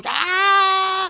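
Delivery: long, high-pitched wailing cry; imitated child's wail. Therapist imitating the little boy crying on arrival, mock wail. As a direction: let out a drawn-out high wail.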